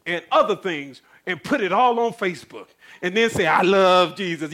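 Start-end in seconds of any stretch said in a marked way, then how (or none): 3.5-4.5 loud, drawn-out declaration of love; mock devotion. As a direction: gush it loudly, stretching the words in mockery. Mock profession of faith by Facebook posters.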